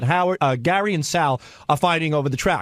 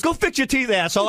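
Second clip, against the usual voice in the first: mock insult; 7 semitones higher than usual. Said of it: "Gary goes".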